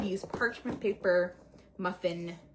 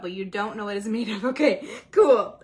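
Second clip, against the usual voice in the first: laughing; 9 dB louder than usual. Real, not acted. Teasing herself, laughing.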